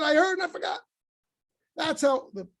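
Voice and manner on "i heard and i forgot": much higher, near shouted declaration; emphatic exclaim. Shown as a demonstration. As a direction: ring it out as the punchline. Teacher stresses Moses' actual words.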